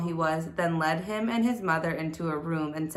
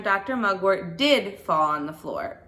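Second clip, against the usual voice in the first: usual speaking voice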